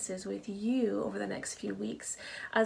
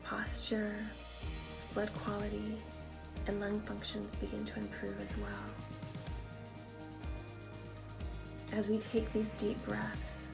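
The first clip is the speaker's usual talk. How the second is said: calm them down, one breath at a time; guided meditation; 8 dB quieter than usual. Acted. Guided meditation over music.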